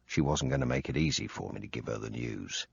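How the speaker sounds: plain reading voice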